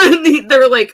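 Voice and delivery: ordinary delivery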